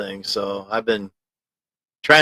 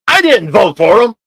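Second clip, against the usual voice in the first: higher, louder acted quote; acted-out chat line; 9 semitones higher, 9 dB louder than usual. Shown as a demonstration. Streamer acting out a viewer's comment, loud. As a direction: act out the viewer's line with punch. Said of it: "Trying to act it out for you".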